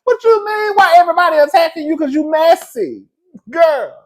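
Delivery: high, loud sassy retort; loud mocking comeback; sassy clapback. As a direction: snap back with sass, laughing. Clapping back at whiny girl.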